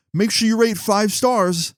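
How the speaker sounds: high, slow imitation of a driver; drawn-out, high-pitched request, putting on another man's voice; imitated driver. Driver asking his escaped passenger for a rating, imitated high voice.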